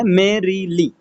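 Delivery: Merrily slowly split into three beats, मे-री-ली; syllable by syllable. Shown as a demonstration. Teacher giving a dactyl example, merrily slowly syllable by syllable. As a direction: separate merrily into मे, री, ली. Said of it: "stressed syllable और दो unstressed syllable".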